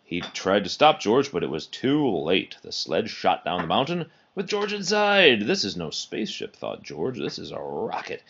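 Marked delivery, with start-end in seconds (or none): none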